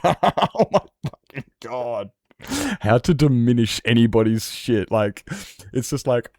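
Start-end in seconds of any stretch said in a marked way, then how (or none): 0.0-2.1 slow, loud disbelieving gasp of words; stunned. Retold or imitated. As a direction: draw it out in shock. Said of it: "And I'm just like".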